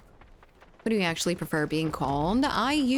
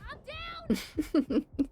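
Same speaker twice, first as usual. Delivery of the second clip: a sudden high squeal of a laugh; 3 dB quieter than usual. Real, not acted. Laughing at chat's joke, high squealing laugh. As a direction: laugh breaks up high and airy.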